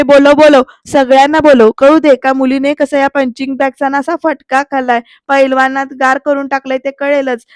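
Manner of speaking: loud, fierce challenge; shouting dare. Retold or imitated. Aarti shouting at Pruthvi to call everyone. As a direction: tell him to call everyone.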